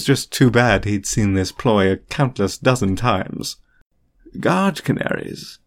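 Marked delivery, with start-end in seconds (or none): 4.4-5.6 slow, high-pitched dubious repeat; drawn-out, amused disbelief; amused skepticism. A narrator's reading. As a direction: repeat it slowly, drawing out the doubt. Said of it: "he repeated with a quirked eyebrow".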